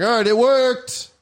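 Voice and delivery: high, drawn-out mock celebration; hammed-up little cheer; retold delight. Delight that his charge went off.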